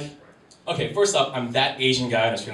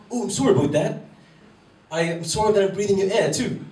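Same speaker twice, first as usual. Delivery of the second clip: higher-pitched, hammed-up apologetic Canadian; mock Canadian apology, pitched up; bad Canadian accent; 6 semitones higher, 3 dB louder than usual. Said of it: "I can't do a Canadian accent".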